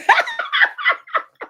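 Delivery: shrieking high burst of laughter. Real, not acted. Mocking the plaintiff's loss, a shrieking laugh.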